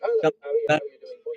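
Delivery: high, warped looping playback; chipmunked audio echoing back over the call; distorted echo. Real, not acted. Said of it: "you don't need to repeat my eyesight".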